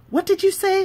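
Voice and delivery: can't believe what she heard; surprised question. Acted. Salma to Lily, shocked and high. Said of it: "Salma asked".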